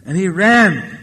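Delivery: usual speaking voice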